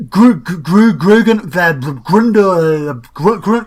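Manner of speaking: loud, panicked stammer; feigned breathless sputtering; huffing and puffing. Pretend. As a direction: stumble over the name, too winded to finish. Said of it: "huffing and puffing and shouting".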